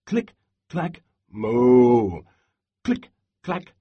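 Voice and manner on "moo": moo. Acted. Cows typing and mooing.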